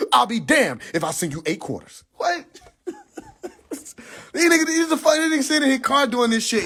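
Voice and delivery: ordinary talk, nothing marked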